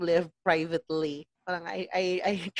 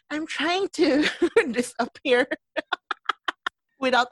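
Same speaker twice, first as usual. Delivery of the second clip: laughing; 6 semitones higher than usual. Real, not acted. Laughs mid-sentence.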